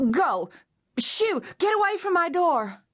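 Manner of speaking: high panicked shout at the door; frightened shout. A narrator's reading. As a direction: shoo him off in fear. Terrified woman shouting at stranger.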